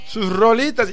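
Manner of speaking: usual speaking voice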